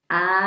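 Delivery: long rising chant ending in a laugh; aloha laughter. Shown as a demonstration. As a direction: draw the word right out, then burst into ha-ha. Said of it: "let's try that one more time".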